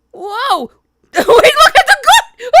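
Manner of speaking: very high shrieking laughter through words; laughing shock. Real, not acted. Player amazed by a character's entrance, laughing. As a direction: squeal through helpless laughter.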